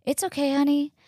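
high, soothing coo; imitating a kindly nurse comforting a patient; sweet older nurse. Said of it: "she's such a sweet lady".

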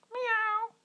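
very high, loud squealing meow. Acted. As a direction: let out a startled cat cry. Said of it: "roept de muis".

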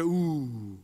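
a lightly drawn-out mock "Ooh"; mock impressed ooh. Shown as a demonstration. Cueing the audience to coo at the nomination, mock impressed. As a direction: lead the crowd in a hammed-up ooh. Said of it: "Everyone go".

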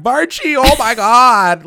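high, loud gushing squeal, slowed on oh my God; mock fangirl squeal. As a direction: squeal like a swooning fan. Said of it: "because they're like".

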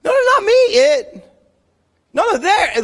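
high, panicky mock protest; Adam and Eve blaming. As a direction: squeal out the excuses like a caught kid passing blame. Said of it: "here's the response of Adam and Eve".

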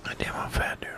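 whispered self-mockery. Real, not acted. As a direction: breathe it out to yourself. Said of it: "self remark whispered".

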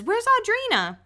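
a lightly mocked reality-show voiceover; dramatic voiceover. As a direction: announce it like a reality-show cliffhanger line. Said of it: "very clear voice that is a voiceover".

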